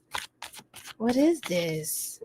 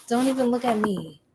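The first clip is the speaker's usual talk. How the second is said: usual speaking voice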